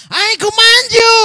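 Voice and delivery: sudden high, loud command; Kuya Jeff commanding. Acted. Playing Paul turning on the spirit, commanding shout. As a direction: wheel round and shout the order. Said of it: "Kunyari, si Kuya Jeff to, binalikan niya".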